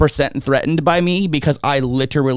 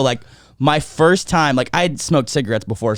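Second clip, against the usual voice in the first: ordinary delivery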